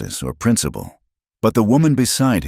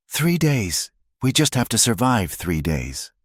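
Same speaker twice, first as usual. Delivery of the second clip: high-pitched, hopeful urgency; urgent hope; 7 semitones higher than usual. A narrator's reading. May grabs Eli's hand at the news, urgent hope. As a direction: seize on the news, starting high. May hears marshals are coming.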